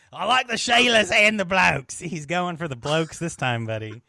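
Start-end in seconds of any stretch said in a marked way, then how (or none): 0.1-2.0 louder, higher hammed-up Australian accent. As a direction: ham up the Aussie bloke. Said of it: "say in an Australian kind of accent".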